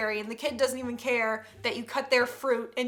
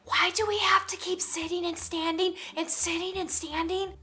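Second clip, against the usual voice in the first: put-on whispered child's complaint; whispering; 5 semitones higher than usual. Nine-year-old asking mother in church, whispering. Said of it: "nine-year-old whispers".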